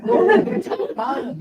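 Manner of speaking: high, laughing repeat of the worry; laughing mock panic. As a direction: squeal it through a laugh. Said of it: "Lee looks at me and goes".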